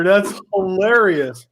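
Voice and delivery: normal talking voice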